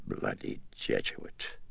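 under the breath, unvoiced; muttered aside. A narrator's reading. Dennings cursing the priest under his breath. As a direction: hiss it under your breath.

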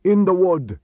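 high, loud shout; cry. A narrator's reading. Horseman to the other men hunting Chris, loud cry. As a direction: holler it out to the search party. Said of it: "He heard one cry".

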